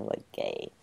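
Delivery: quiet breathy joke aside; hushed punchline; whispered aside. Real, not acted. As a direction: slip it in under your breath. Punchline aside after gamer pun.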